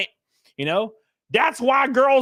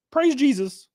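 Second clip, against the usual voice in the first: ordinary talk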